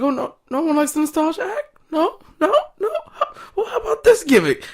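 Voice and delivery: very high cartoonish taunt, ending in a laugh; mock taunting. Reviewer voicing Ziggler mocking the crowd, very high. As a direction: tease them in a squeaky voice. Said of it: "He's like".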